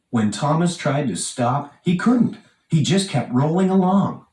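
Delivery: ordinary delivery, nothing marked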